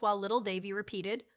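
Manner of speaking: usual speaking voice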